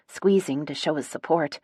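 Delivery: plain reading voice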